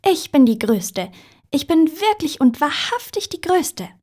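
high, loud puddle boast; crowing, high and loud; boastful calling out. A narrator's reading. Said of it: "hörte er sie schon von weitem rufen".

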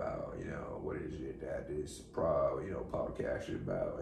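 low, lazy celebrity drawl; put-on mellow star voice; laid-back celebrity impression. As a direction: ramble like a mellow movie star. Stays in character, steering the show.

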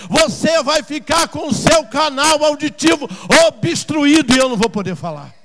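loud, high impassioned sermon; full-force preaching. Acted. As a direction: preach at a shout, driving each phrase home.

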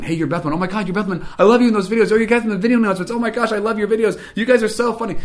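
a starstruck fan pouring it out; gushing fan. Retold or imitated. Fans gushing to Bethel Man about the videos, high and thrilled.

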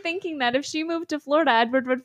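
loud, high, exasperated disbelief; incredulous rant. Real, not acted. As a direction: rant loudly in disbelief, breaking into a laugh. Host exasperated at the heroine.

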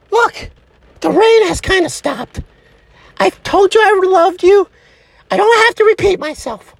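high-voiced, curt irritation; fed-up sweetheart in a high voice; impatient girlfriend. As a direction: refuse to indulge your needy partner. Girlfriend turns impatient with him.

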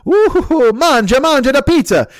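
high, hammed-up Italian accent; mock Italian accent. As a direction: sing it out like a cartoon Italian pizza man. Pizza place's mock Italian reply.

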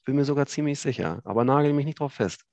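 ordinary delivery

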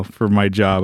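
ordinary talk

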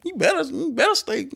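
squeaky high-pitched insistence; playful falsetto warning; mock pleading. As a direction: beg listeners in a tiny high voice. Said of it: "Part of me is like".

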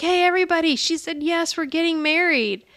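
mock proud call to everyone in the restaurant. Fiancé announcing to restaurant.

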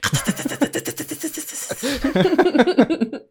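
high, hearty burst of laughter. Real, not acted. Laughs at rattlesnake joke.

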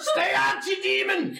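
high-pitched dwarf shout. Acted. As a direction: yell it at an intruder as a dwarf. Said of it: "It's the stereotypical dwarf".

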